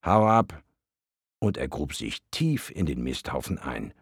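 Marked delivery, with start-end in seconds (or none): 0.1-0.6 high, loud shout of get lost; telling off. Acted. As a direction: bark it at him. Worm tells deva off.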